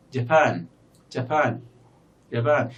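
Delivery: Japan with a long, strong second syllable; stress on second syllable. Shown as a demonstration. Teacher proving the second syllable is longer, end-stressed Japan. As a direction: skim the first syllable of Japan and stretch the last. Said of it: "second syllable in Japan is longer".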